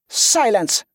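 sharp order without a real shout; whip-crack order. A narrator's reading. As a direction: cut them off like a commander. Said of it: "ordered, her voice cracking like a whip".

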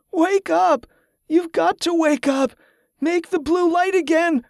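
very high, desperate wailing; tearful wail. A narrator's reading. Delirious man begging Rose to wake, high desperate wail. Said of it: "Tesca wailed, tears streaming from his eyes".

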